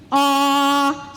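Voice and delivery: long held ah on the in-breath; one long even ah sound; sustained ah. Shown as a demonstration. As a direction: draw the breath in on a steady ah. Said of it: "you speak on that in".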